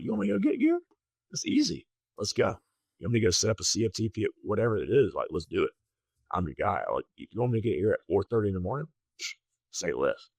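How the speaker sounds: high, eager, keen new guy. Retold or imitated. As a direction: pitch up, overly eager volunteer. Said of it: "And I was just eager".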